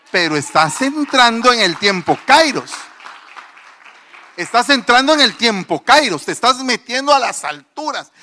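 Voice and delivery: loud, driving sermon crescendo; rousing preaching. Real, not acted. Preacher urging the congregation up to the heights, rousing preaching. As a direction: preach it with mounting force, punching the key words.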